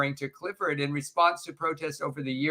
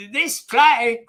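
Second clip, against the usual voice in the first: failed Scottish accent; 9 semitones higher, 8 dB louder than usual. Shown as a demonstration. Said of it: "I can't do it".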